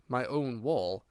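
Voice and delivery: ordinary delivery, nothing marked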